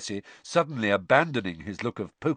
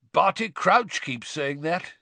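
high, slow, wide-eyed innocence; innocent; 8 semitones higher than usual. A narrator's reading. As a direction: play innocent, stretching it out. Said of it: "his round eyes widening innocently".

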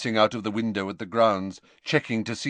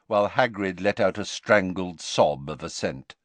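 usual speaking voice

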